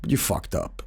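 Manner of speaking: low menacing finality; low smug intruder. Retold or imitated. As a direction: deliver the verdict coolly. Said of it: "And he's like".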